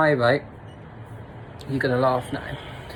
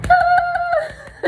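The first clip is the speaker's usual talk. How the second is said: high shrill gasp of panic; panicked yelp; 5 dB louder than usual. Retold or imitated. Panicked yelp recalling racing heart on drugs. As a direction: yelp like your heart just jumped.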